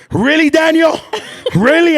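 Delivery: mock dismay, pitched high and loud; exasperated disbelief. Gentry catches them, exasperated.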